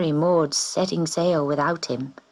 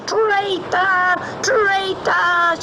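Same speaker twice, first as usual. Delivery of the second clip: much higher, shrieking witch; witch crying traitor; 13 semitones higher, much slower than usual. A narrator's reading. Sea Witch crying out at the kitten as she vanishes, shrieking. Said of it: "she cried".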